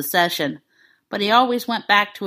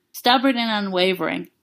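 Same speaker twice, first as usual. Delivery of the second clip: plain reading voice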